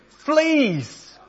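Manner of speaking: sudden high alarmed cry. Acted. Corrie, finding fleas in the barracks, cries out high in alarm. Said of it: "I cried".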